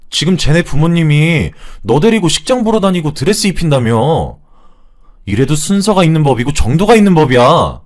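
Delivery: building to loud, high-pitched outrage; furious older brother's telling-off. Acted. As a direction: scold them at full voice. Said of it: "큰 소리를 내며 화를 내니".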